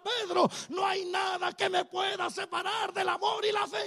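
loud, high triumphant proclaiming; full-force preaching. Acted. Preacher voicing Peter's triumph over death, shouting. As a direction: preach the resurrection at the top of your voice.

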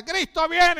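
put-on high, loud cry of the old days; the old-time preacher's excited cry; fervent proclamation. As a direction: announce it with full fire. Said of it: "Cuarenta años atrás yo me decía".

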